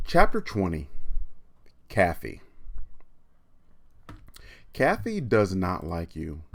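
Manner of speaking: put-on lively read-aloud for kids, emphatic; animated storytime narration; story reading. A narrator's reading. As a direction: read it out to the class with energy. Teacher reads chapter aloud.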